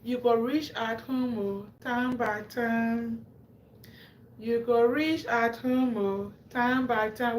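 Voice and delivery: slow, sing-song quoted proverb; drawn-out saying. Retold or imitated. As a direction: stretch the old saying out slowly. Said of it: "They say".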